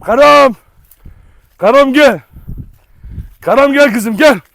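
loud, high call of the name; loud coaxing call; calling the dog. Real, not acted. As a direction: call her over across the garden.

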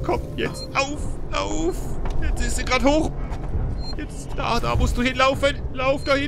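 high frantic urging; being chased. Real, not acted. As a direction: hurry her on in panic.

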